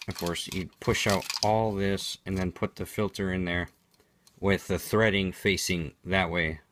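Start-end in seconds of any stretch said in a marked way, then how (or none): none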